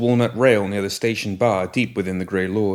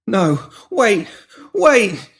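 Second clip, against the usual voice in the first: much higher, louder frantic begging; panicked pleading; 12 semitones higher, 6 dB louder, much slower than usual. Acted. Pinky pleading with the woman holding shears, panicked. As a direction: beg for your life, backing away.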